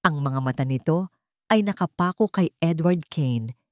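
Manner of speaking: ordinary talk, nothing marked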